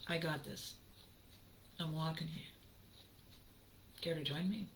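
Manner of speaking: softly inviting the audience along; quiet invitation. Acted. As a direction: draw them in gently.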